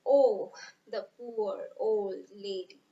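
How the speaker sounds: put-on slow, weighted Oh, the poor old lady; slow Oh, the poor old lady with stress on poor; stressed, slow pity. Shown as a demonstration. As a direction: dwell on poor, full of pity. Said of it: "the poet stresses on the poor old lady".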